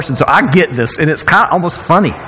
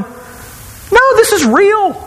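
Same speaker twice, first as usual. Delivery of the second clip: emphatic; much slower than usual. Retold or imitated. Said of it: "And I'm going".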